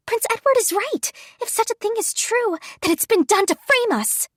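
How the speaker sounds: put-on insistent young woman protesting; Yuri exclaiming. A narrator's reading. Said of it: "Yuri exclaimed".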